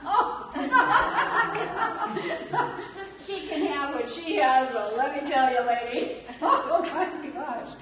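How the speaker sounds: loud laughing through the words; laughing hard, high and loud; real laughter. Real, not acted. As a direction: crack up and push the line out laughing. Laughing at her own punchline.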